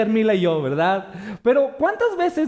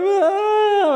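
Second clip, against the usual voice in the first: mock baby cry; 10 semitones higher, 4 dB louder than usual. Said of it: "empezó a llorar".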